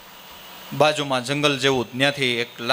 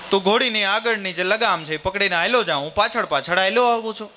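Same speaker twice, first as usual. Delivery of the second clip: pitched much higher, lively storytelling voice; voiced character's plan; 8 semitones higher than usual. Father proposes they both walk, higher animated character voice. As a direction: lay out the plan brightly in a raised character voice. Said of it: "કે".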